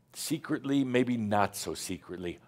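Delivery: ordinary delivery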